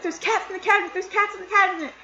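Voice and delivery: high-pitched frantic repetition; acted freak-out. Kid tells family coming downstairs about cats, acted freak-out. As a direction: replay the panic in a high, urgent voice. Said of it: "I'm freaking out".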